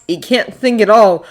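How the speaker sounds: ordinary delivery, nothing marked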